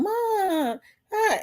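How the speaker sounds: lightly voiced daughter, a hint of her dad's sly drawl; imitating daughter. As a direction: copy the daughter's sly, drawn-out "Mom, huh?". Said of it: "My daughter talks just like him".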